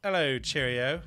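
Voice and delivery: bad mock British accent; a weak stab at a posh British greeting. Friend asks for his William Regal, bad British accent. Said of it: "how would your William Regal sound".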